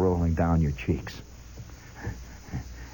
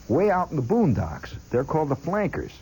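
usual speaking voice

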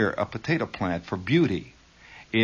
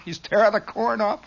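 usual speaking voice

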